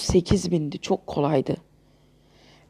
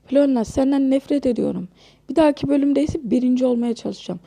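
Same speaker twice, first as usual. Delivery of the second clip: normal talking voice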